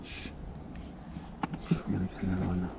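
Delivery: fast hushed voice; fishing whisper. Real, not acted. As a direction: hush so the fish stays.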